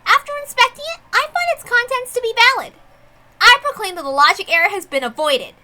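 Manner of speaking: high, lilting proclamation of the witch; proclaiming witch. Acted. Witch overseer proclaims to the crowd, high and playful. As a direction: announce the verdict with a playful high voice.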